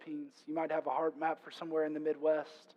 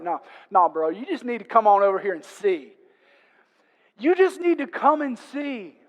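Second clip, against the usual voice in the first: loud, insistent brush-off rising higher; urgent invitation; 5 semitones higher, 11 dB louder than usual. Acted. Philip to his bro Nathanael, loud urgent invitation. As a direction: cut through the debate and urge him along.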